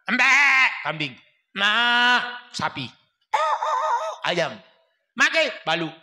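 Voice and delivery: loud calls up to the house; calling out loud. Retold or imitated. Son arriving home calling to his mother, loud. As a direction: shout up from below the house.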